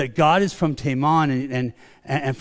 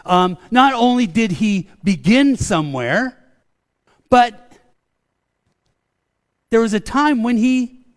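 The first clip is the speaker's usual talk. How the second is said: louder, higher preaching rant; rising rant; 7 semitones higher, 7 dB louder than usual. Real, not acted. Pastor ranting to the congregation about begotten, louder and higher. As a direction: build it up, preaching with fire. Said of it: "this is where my rant comes in".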